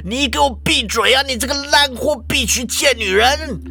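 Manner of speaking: high-pitched furious denial; frantic, worked up. Acted. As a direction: protest hotly, climbing into a tirade. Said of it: "校长就急了".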